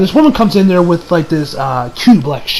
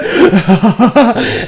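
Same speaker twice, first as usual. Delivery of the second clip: villain laugh; 3 semitones higher than usual. Retold or imitated. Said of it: "the dude is just sitting there like".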